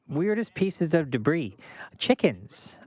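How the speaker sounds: ordinary delivery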